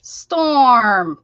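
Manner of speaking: drawn-out, loud call with falling pitch; calling the cat. Real, not acted. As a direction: call out sharply for a pet. Calls out to the yelling cat.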